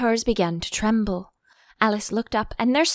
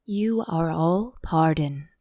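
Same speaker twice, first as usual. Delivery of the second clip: slow whispered pardon; low voice; 6 semitones lower, slower than usual. Acted. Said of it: "say in a low voice".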